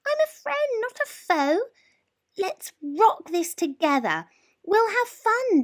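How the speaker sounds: high, gentle mouse voice; peeping mouse, friendly. A narrator's reading. Mouse comforting the frightened lion. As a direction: reassure the scared lion kindly. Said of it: "Mouse peeped".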